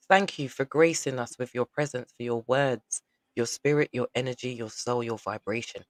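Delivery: ordinary talk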